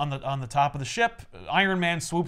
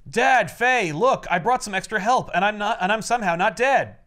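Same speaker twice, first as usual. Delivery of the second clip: put-on higher, louder eager boy; excited kid; 5 semitones higher, 4 dB louder than usual. Ben showing his father the help, eager and higher. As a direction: burst in proud and breathless. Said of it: "Ben has a great line where he says".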